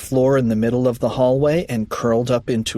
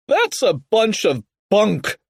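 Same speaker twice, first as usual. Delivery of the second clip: higher, scornful holler; yell; 8 semitones higher than usual. A narrator's reading. Mister Krupp dismissing terrifying story, raised scornful yell. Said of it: "yelled Mister Krupp".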